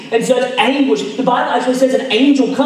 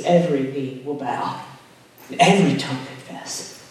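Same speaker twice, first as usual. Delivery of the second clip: reverent recitation; 7 semitones lower, 7 dB quieter than usual. Acted. Pastor reciting Paul's words to the church, low and reverent. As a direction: lower and softer, like a creed. Quoting Philippians as solemn creed.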